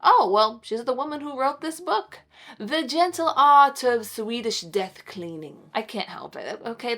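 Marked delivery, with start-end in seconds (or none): 2.6-5.7 mock British accent. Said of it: "This title forces you to say it like that".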